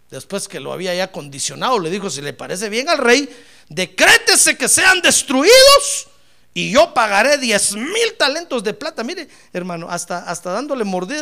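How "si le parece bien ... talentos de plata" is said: loud proclamation, peaking high and slow; declaimed petition. Acted. Adviser offering silver to the king, loud declaiming. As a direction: build to a shouted decree, then ease slightly.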